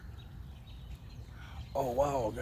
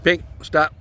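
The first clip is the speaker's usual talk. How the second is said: loud, raised-pitch order to a dog; sharp command; 6 semitones higher, 11 dB louder than usual. Real, not acted. Owner telling the dog to stop, sharp command. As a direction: call the dog off firmly.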